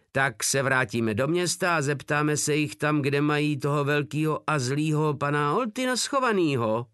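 acted smirking proposal; sneering mockery. A narrator's reading. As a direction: jeer with pitch lifted. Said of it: "ušklíbil se Porta".

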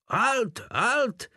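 very high shrieked halt, voice cracking; shriek with breaking voice. A narrator's reading. Captain shrieking after the soldier galloping away. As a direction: scream it until the voice breaks. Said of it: "zaječel von Páder a hlas se mu zlomil".

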